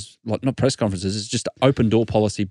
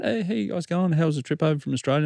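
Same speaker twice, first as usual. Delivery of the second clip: lightly voiced friendly star, higher and softer; breezy polite hello, pitched up; friendly greeting; 7 semitones higher, 5 dB quieter than usual. Retelling the tennis star's greeting, a higher, softer friendly voice. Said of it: "he just stops for a chat".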